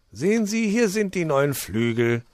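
high, loud, jolly fox voice; high-pitched welcoming fox; cheerful fox. Acted. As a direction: play the hospitable old fox, beaming. Fox voice held.

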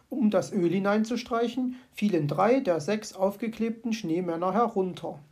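ordinary delivery

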